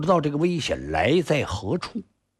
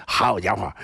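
usual speaking voice

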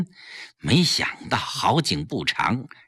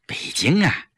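halting, evasive answer with long pauses; hesitant, broken-up reply; hemming and hawing; 4 semitones lower than usual. A narrator's reading. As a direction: dodge the question with a sigh. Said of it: "季嫣然支支吾吾地说".

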